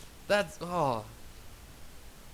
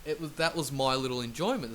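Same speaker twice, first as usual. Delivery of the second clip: ordinary talk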